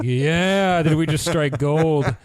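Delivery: high, loud and gleeful, slow; gloating like you just struck gold; excited. Retold or imitated. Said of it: "they were like".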